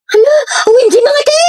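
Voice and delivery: high squealing delight over a crush; screaming fangirl over a voice message; kilig squeal. Acted. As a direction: shriek with giddy excitement.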